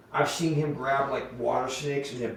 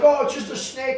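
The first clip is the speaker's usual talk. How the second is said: casual reassurance; 10 semitones higher, 6 dB louder than usual. Retold or imitated. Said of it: "I'm like".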